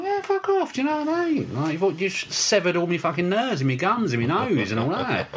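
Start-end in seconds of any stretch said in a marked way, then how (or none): 0.0-1.6 high, squeaky sarcastic brush-off; mock scoffing. Retold mocking reply, very high.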